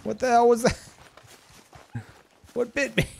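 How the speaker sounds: high, loud startled outcry; shocked yell. Real, not acted. Streamer attacked by unseen creature, shocked yell. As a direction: blurt it out stunned.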